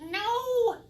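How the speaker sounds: sudden high shout of alarm; startled cry. Acted. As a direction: cry out in shock. Trick goes wrong, cries out.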